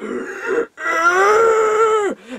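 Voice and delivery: long high-pitched creaky zombie moan; mock undead wail, drawn out. Emaciated figure appearing in one panel, ghoulish wail.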